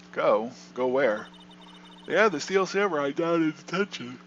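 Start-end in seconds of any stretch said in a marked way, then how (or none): 2.1-4.2 talking through a yawn; words stretched by a yawn. Real, not acted. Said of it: "I'm yawning so much".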